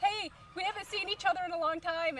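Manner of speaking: high, loud, delighted call; excited greeting. Retold or imitated. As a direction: imitate a friend calling out at a reunion. Friend's excited shouted greeting, retold.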